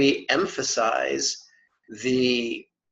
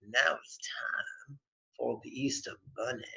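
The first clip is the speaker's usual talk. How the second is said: soft, breathy stage whisper; hushed mock-mysterious aside; playful whisper; 4 semitones lower, 13 dB quieter than usual. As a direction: whisper it like a little secret.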